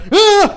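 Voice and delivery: loud sudden yelp; imitated cry of a seizure starting; mock seizure cry. Comedian's seizure bit retold to friends, mock yelp. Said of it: "and he would go".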